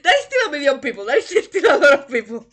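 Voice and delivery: loud, high, laughing insistence; real laughing outburst at full volume; laughing exclamation. Real, not acted. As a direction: burst out in amused disbelief, laughing through it. Exclaiming over the million hits.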